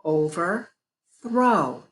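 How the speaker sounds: very slow "Overthrow" split into chunks; slow chunking. Shown as a demonstration. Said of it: "How many bigger chunks?".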